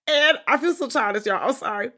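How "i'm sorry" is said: ordinary delivery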